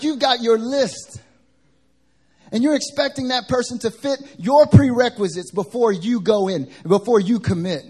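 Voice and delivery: high, loud confrontational sermon delivery; imitated angry preaching. Worship pastor rebuking sisters over prerequisites, loud preaching imitation. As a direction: imitate a pastor railing at his passengers. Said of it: "John is preaching".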